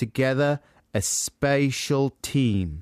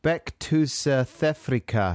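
slow "Beck to South Africa" as a South African "back to the future"; South African accent; 2 semitones higher, slower than usual. Shown as a demonstration. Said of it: "This is another South African one".

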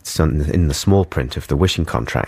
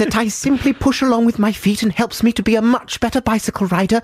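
put-on much higher, polite pleading child; sweet little boy. Dad voicing the dream wish of his six-year-old son, high pleading child voice. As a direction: coo like a perfectly well-behaved son.

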